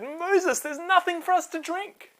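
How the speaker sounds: high, whiny plea of thirsty people; complaining crowd. A narrator's reading. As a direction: whine it up high like a parched crowd. People complaining, quoted in story.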